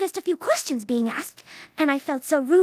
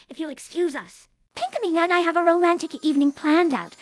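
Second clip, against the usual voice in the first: ordinary talk, nothing marked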